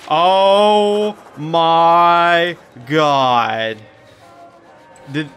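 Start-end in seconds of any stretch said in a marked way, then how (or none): 0.1-3.8 very slow, louder stretched-out gasp of disbelief; drawn-out awe. Real, not acted. Player stunned by what he sees, slow, loud disbelief. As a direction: let each word hang in shock.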